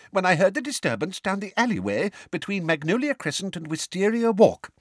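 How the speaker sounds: fast, high-pitched witness voice; gabbled. A narrator's reading. Witness goes on with her rehearsed account, gabbling. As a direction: rattle it off like memorised testimony. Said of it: "gabbled Missus Figg at once".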